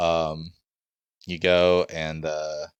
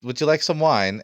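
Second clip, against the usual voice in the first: high, loud put-on voice; high-pitched effusive invitation; flamboyant host; 6 semitones higher, 8 dB louder than usual. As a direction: offer hospitality in a big high voice. Host offers wine in character.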